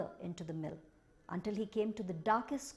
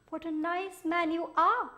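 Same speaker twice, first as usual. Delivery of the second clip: high, loud, sweet creature voice; butter him up, high and sugary; flattering creature; 9 semitones higher, 5 dB louder than usual. Acted. The Minihos flattering Jobiho, high and sweet. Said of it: "said the Minihos".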